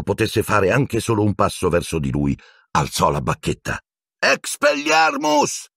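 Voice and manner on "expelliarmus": very high, drawn-out cry; shouted spell. Acted. Harry disarming Snape, shouting the spell. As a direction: cast it with a long high yell. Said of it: "gridò, ma la sua non fu la sola".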